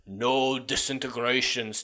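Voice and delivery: slow, badly faked villain command; terrible Darth Vader. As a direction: draw it out like a bad movie villain. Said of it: "That's a terrible Vader impression".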